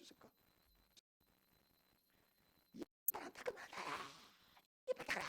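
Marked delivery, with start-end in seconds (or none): 2.7-5.2 hoarse imitation of a screaming mother; mock scream, strained but held down in volume; mother's morning yelling. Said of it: "아침마다 소리 지르는 소리".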